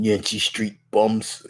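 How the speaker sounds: slow, soft Brooklyn grumble; understated Brooklyn accent; low, unhurried Brooklyn tough guy; Ben Grimm. Acted. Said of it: "straight Brooklyn accent coming out of him".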